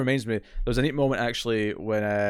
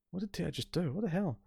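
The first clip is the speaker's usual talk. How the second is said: higher and much softer, a bewildered murmur; hushed baffled crew; 5 semitones higher, 10 dB quieter than usual. Retold or imitated. Crew whispering among themselves, higher hushed bewilderment. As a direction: mutter it under your breath in disbelief. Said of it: "everyone's like".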